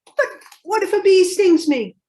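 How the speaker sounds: put-on high, loud anxious little kid asking; worried child voice. Child asking mother about bee stings, high anxious little voice. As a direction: ask it like a fretful small child.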